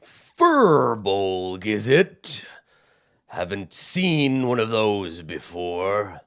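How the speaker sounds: a towering giant quizzing the little furbolg; curious giant. Acted. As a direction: lean in and ask it like a curious giant. Giant voice continues.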